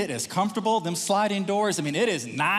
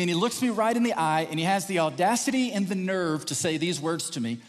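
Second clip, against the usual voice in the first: ordinary delivery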